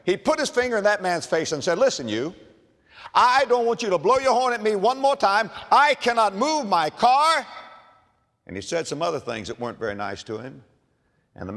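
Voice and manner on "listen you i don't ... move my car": higher and louder, jabbing each word at him; angry outburst. Acted. Executive telling off the driver behind him, angry outburst. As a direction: tell the honking driver off, furious. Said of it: "so angry".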